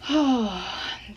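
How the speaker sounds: long sigh as the work ends; sigh of relief. Acted. Mindy finishing her report at her desk, relieved sigh. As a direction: breathe out the strain.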